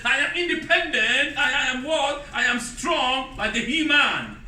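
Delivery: high, loud sing-song boast; hammed-up boasting modern woman, slowed at the end; mock modern woman. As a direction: lampoon a proud independent woman, half singing. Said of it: "In the twenty-first century".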